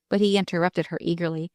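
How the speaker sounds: ordinary delivery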